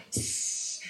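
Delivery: sizzle. Retold or imitated. Meatball dropped in hot oil.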